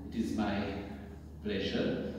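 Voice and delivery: normal talking voice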